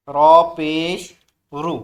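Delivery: slow, loud drilled syllable; teacher's loud slow recitation. Shown as a demonstration. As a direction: call it out loud for the class. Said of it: "ज़ोर से बोलें".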